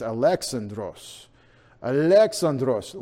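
Alexandros slowly, as a-LEX-an-dros; slow "Alexandros", stress on second syllable; Greek pronunciation. Shown as a demonstration. Preacher lets them hear it in Greek, Greek pronunciation. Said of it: "wanted you to hear it in Greek".

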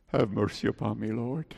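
the plea sinking almost to nothing on Lord; hushed plea. Retold or imitated. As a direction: murmur it low as she lies bowed. Voicing the woman's prayer, hushed.

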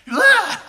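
loud mock vomit noise; hammed-up "blah" of throwing up; imitated retching. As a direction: fake a big retch. Imitates teenage son throwing up.

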